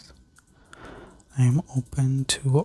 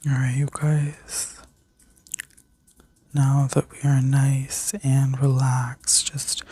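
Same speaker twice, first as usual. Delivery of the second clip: sleep story narrator. Acted. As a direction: guide them gently into rest. Leads a guided healing meditation.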